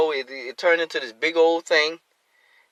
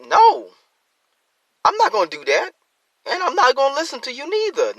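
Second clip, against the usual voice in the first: defiant refusal; 11 semitones higher, 3 dB louder than usual. Retold or imitated. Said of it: "don't listen to them say".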